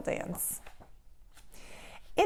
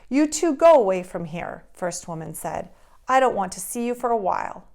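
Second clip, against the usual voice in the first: angry; 8 dB louder than usual. Acted. First Woman sends them away.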